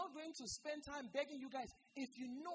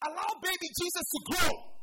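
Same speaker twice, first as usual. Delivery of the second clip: loud, high, ringing exhortation; preaching at full force; 7 semitones higher, 13 dB louder than usual. Real, not acted. Preacher urging congregation at his climax, loud. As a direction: lift the room with it.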